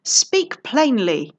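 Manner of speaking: slow, loud, barely held anger; angry demand. Acted. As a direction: bite out each word slowly. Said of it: "having a problem keeping his voice down".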